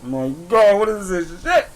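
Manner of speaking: loud, high mock wail of dread; hammed-up anguished cry, rising high; nervous panic. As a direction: re-enact panicking, pitch climbing as it goes. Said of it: "getting nervous as fuck".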